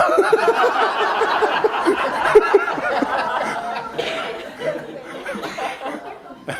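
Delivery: a long, breathless fit of laughter; long helpless laugh. Real, not acted. Telling the audience about the plane talk, long laughter. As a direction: laugh until the breath runs out.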